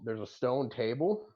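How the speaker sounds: ordinary talk, nothing marked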